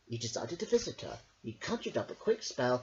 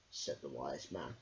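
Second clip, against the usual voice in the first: usual speaking voice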